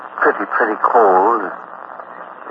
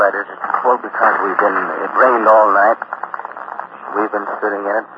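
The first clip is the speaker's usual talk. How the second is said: ordinary delivery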